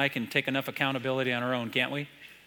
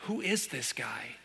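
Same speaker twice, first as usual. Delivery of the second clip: awed hush; 4 semitones lower, 8 dB quieter, slower than usual. Retold or imitated. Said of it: "the disciples looked at each other and thought".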